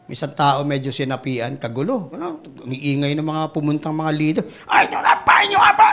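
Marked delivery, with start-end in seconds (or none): 4.7-5.9 loud high yelling at a spirit; noisy leaders yelling at possessed man; mock shouting exorcism. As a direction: shout the demon out.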